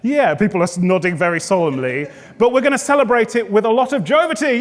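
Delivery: usual speaking voice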